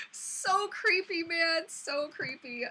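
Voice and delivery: slow, emphatic shudder of words; creeped out. Real, not acted. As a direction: stretch out each word, creeped out. Recalling being creeped out by cat.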